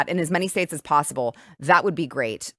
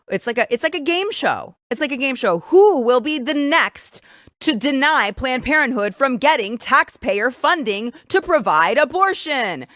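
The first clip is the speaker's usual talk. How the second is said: mock game show host; 6 semitones higher, 4 dB louder than usual. Host mocking states like a game show, hammed-up quiz voice. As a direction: ham it up like a quiz host asking who's next.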